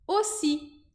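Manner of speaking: "Aussi" said osi, plain O vowel; "Aussi" as osi, slow and clear; French aussi. Shown as a demonstration. Teacher shows students "Aussi" as osi. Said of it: "formam esse som O".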